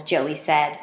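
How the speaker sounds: plain reading voice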